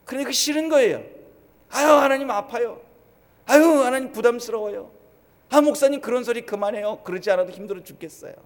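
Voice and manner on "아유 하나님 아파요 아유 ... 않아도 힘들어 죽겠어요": much louder, higher moaning complaint, quickening at the end; whining congregant. Retold or imitated. Churchgoer complaining to God and the pastor, louder, higher whining. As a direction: groan like a churchgoer sick of hard sermons.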